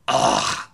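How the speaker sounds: exasperated breathy groan, imitated; audible huff. Passenger pressed for her flight number, mimicked huff. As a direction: mimic her huffing at the agent. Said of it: "and again, just like".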